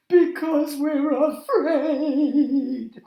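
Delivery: high, slow, fearful creature voice; afraid chorus. A narrator's reading. Night creatures confessing to the parrot, high and slow. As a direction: stretch it out, trembling with fear. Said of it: "answered in a chorus".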